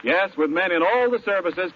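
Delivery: usual speaking voice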